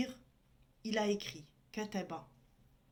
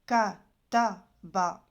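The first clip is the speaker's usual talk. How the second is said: kataba said very slowly and louder, each syllable held; slow stressed demo; 7 dB louder, much slower than usual. Shown as a demonstration. Teacher showing students the vowels, slow and emphatic. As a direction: stretch out kataba so every vowel is heard. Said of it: "comment savoir ici".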